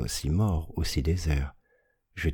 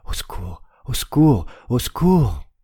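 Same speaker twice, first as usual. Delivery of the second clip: desperate shout; 7 semitones higher, 6 dB louder than usual. Acted. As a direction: shout into the dark, higher and louder each time. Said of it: "Je hurlai".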